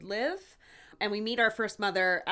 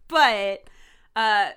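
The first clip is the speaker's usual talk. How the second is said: ordinary talk, nothing marked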